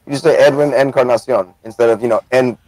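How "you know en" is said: ordinary talk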